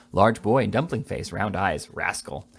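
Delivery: ordinary delivery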